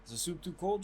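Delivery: lightly voiced fussing character; movie quote impression. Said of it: "have you seen Coming to America?".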